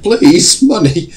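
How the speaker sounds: loud, high hammed-up plea for money; over-the-top comic begging shout; mock begging. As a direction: beg like you're on your knees, overdone. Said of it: "get on bended knees".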